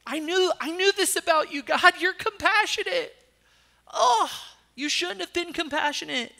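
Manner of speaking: high, loud, put-on outraged prophet; hammed-up fury at a forgiving God; enraged Jonah. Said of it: "Jonah's enraged".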